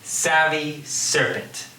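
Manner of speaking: slow "savvy serpent" leaning on each S; "savvy serpent", each word set apart; alliteration demonstration. Shown as a demonstration. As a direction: stretch the opening S in "savvy" and "serpent". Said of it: "repetition of the initial consonant sound".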